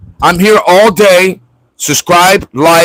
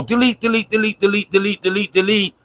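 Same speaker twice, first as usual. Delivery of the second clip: rattled-off, softer, even repetition; rapid-fire repetition; 2 semitones higher, 8 dB quieter than usual. Acted. Streamer showing JP how to erase a comment, rapid soft chant. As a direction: repeat it quickly under your breath like a machine. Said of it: "You go".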